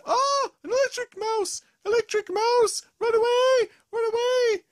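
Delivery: high, loud mock panic; panicked squeal. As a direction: shriek it, running for your life. Gruffalo panics at the mouse.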